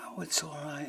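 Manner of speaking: soft, whispery consolation; reassuring. Retold or imitated. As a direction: gently hush an upset friend. Consoling a nearly crying friend.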